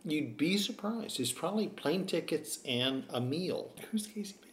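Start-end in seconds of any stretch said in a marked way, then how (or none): 3.9-4.5 a hushed, breathy aside question; whisper. Real, not acted. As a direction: lean in and whisper it.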